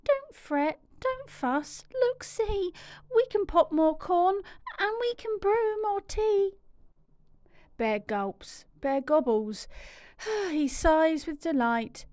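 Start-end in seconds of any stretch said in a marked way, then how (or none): 0.0-6.5 high, tiny squeaking mouse voice; squeaky mouse. Acted. Little mouse complaining about the damp cave, squeaky. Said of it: "Mouse squeaks".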